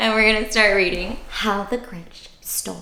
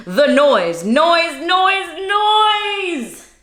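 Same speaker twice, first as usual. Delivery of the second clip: exasperated noise; 9 semitones higher, 4 dB louder, much slower than usual. Acted. Grinch hating the noise.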